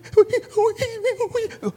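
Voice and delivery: high, sharp mock gasp; shocked gasp. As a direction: gasp as if struck. Actress after being hit.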